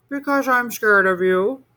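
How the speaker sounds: high little monster voice; a monster confessing his fear; scared child. A narrator's reading.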